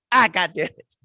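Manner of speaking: hammed-up dad swearing; dad cursing.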